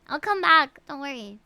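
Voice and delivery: ordinary delivery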